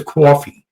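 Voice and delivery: "Coffee" as kwaw-fee with a rounded Bronx aw, imitating. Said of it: "And then she also says".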